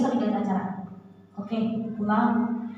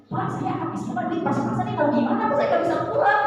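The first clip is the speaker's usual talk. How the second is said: high, begging plea; pleading passenger; 6 semitones higher than usual. Retold or imitated. Stranded student begging the conductor to hold the train, high pleading. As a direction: beg the conductor with rising urgency.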